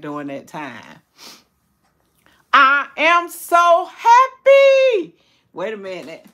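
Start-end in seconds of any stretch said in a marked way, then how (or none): 2.5-5.2 high, loud, slow happy cry; tearfully happy declaration; joyful. Real, not acted. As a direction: let the joy spill over. Emotional joy.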